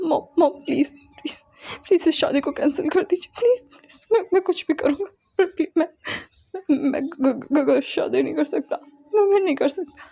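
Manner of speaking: high, broken sobbing pleas; tearful begging. Acted. Son sobbing, begging mother to cancel the wedding. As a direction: beg through sobs, voice cracking. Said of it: "रोते हुए कहता है".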